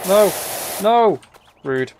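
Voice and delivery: normal talking voice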